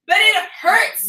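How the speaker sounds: slow, high whiny complaint; bad acting. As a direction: whine it out like a drama queen. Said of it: "Olivia cut her finger?".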